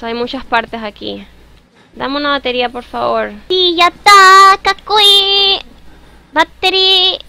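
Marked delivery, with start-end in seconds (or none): 3.5-7.2 high, loud, slow excited cheer. Real, not acted. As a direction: cheer it out with delight. Excited finding item.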